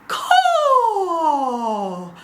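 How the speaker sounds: high, piercing bird cry; posh eagle caw. Shown as a demonstration. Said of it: "they called it the posh eagle".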